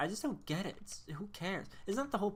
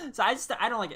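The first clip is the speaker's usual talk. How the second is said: higher and louder, still laughing; 6 semitones higher, 10 dB louder, much faster than usual. Real, not acted. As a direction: say it while laughing it off. Laughing after the rant.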